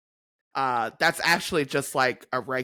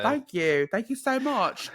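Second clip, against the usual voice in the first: higher, chatty British accent; put-on English accent; 8 semitones higher than usual. Shown as a demonstration. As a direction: show off a good English accent. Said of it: "put on a good accent".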